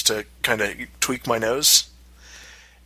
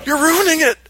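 high, loud frantic pleading; desperate squeal to keep her seated; husband begs wife to sit back down, high panicked cry; panicking; 3 dB louder, slower than usual. Retold or imitated. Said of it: "panicking at that point".

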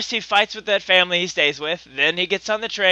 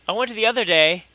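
ordinary talk

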